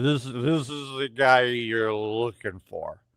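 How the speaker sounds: put-on slow, slurred drunk insisting; hint of a drunk man insisting; lightly voiced drunk. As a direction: lean on it like a tipsy stranger.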